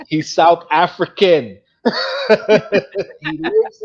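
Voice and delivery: chuckling at his own mistake; laughing. Real, not acted. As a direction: laugh through the correction.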